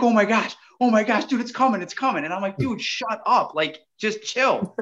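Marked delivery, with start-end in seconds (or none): 0.0-2.2 higher-pitched, breathless-rush impression of an excited buddy; imitated giddy excitement. Buddy watching the fish close in, imitated high excitement. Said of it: "getting so excited". 2.6-4.8 higher-pitched retold plea, slowing to settle him; exasperated hushing. Angler calming his excited buddy, mock exasperated hushing.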